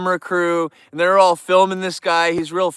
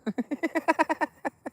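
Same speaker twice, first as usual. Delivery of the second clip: high, light, rattling giggle; high-pitched staccato titter; contagious laugh; 10 semitones higher, 10 dB quieter than usual. Real, not acted. Streamer tells everyone to laugh, high giggle.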